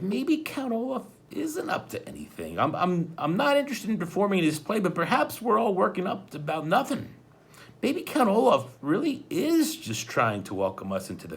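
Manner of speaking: a hint of a hesitant sister; lightly voiced girl, doubtful.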